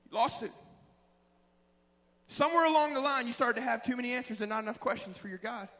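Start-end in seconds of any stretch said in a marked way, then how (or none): none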